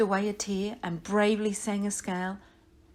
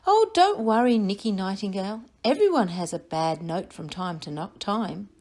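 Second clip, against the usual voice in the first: comforting Nellie Nightingale. Acted. Nellie Nightingale reassures nervous Nicky, comforting bird voice. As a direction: reassure him gently as Nellie.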